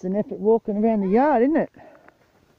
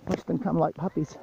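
ordinary talk

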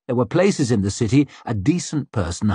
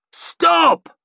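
raised, forceful shouted command; roar; 3 dB louder than usual. A narrator's reading. Curbman shoving through the mob, roaring. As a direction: roar to break up an attack. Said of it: "roared Curbman".

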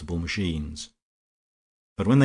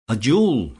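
loud, high burst of realisation; sudden exclamation; 5 semitones higher, 8 dB louder than usual. Acted. March realises the truth and exclaims, loud and high. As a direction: blurt it out as it dawns. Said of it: "exclaimed March".

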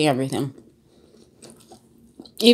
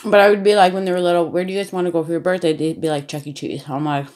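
talking while licking fingers; speaking around fingertips mid-meal; fingers in mouth; faster than usual. Real, not acted. Seen 2: Fingers at lips and in mouth, pizza held after a bite.

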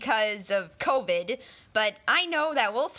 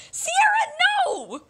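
very high, louder call; alarmed cry; 5 dB louder than usual. Acted. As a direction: call her name in alarm. Calls out alarmed.

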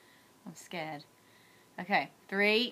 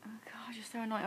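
exasperated whisper; 11 dB quieter than usual. Real, not acted. Annoyed at husband off camera.

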